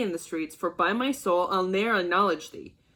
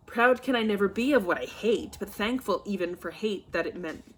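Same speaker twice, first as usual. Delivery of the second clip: usual speaking voice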